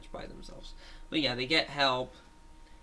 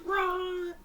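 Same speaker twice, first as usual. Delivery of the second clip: shouting. Retold or imitated. Child acting out cartoon shout.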